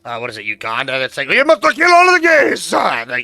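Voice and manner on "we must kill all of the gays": high shouted attempt at a Ugandan accent; botched Ugandan accent, loud and high; failed Ugandan accent. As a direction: yell it in a bad Ugandan accent. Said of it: "I can't do a Ugandan accent".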